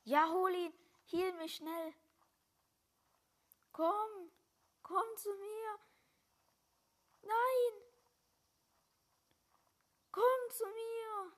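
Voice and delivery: high, loud frantic calls for help; panicked yelling at a teammate; panicking gamer. Real, not acted.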